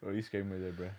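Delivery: ordinary delivery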